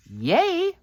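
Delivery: high little cheer of delight; excited cheer. Real, not acted. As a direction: celebrate the finished card. Card finished, cheering.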